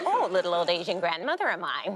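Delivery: mock old grandmother; Yoda-like grandma. Mom voiced as Yoda-like grandmother.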